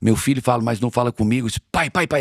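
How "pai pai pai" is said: put-on child calling dad. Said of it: "ele não fala pai".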